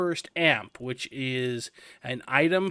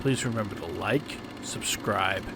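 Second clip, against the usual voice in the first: lower-voiced show announcer closing the episode; announcer sign-off; 8 semitones lower, 4 dB quieter than usual. Channel outro sign-off.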